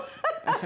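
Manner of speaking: a sudden high burst of laughter; high nervous laugh at her jab. Real, not acted. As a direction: laugh it off, caught out.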